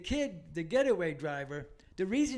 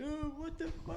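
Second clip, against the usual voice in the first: high, soft imitation of a tearful aunt; mock crying; 9 semitones higher, 4 dB quieter than usual. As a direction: lightly wail it like a distraught aunt. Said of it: "she's crying".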